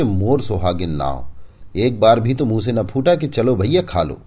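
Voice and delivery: high, loud mocking reproach; loud, sarcastic wife goading her husband; taunting wife. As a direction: needle him and jeer.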